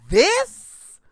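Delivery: leaps way up and loud on one word; hyped. Real, not acted. Praising the song to the artist, hyped and loud. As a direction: jump on the word this with full hype.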